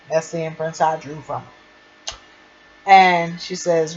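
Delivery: normal talking voice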